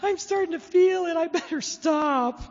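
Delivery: very high, giggly mom impression; giddy mom. Mom after a couple glasses of wine, giddy and laughing. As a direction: squeal it tipsily, stretching the ending. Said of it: "she gets all laughing, goes".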